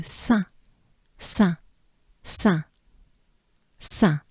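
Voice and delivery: "Saint" ending on a nasal vowel; French nasal vowel. Shown as a demonstration. Teacher drilling part of a name, French nasal vowel. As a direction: repeat "Saint" separately, n and t silent. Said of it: "it goes into".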